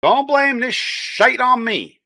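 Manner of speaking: high, slow, drawn-out protest; worked-up drawling comeback; indignant refusal. Real, not acted. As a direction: push the blame away, high and stretched out. Refusing blame in the playful argument.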